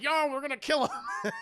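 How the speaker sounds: high laughing bravado; laughing, hammed-up high cop voice; laughing mock cop. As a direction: crack up mid-line as a trigger-happy cop. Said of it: "It's like".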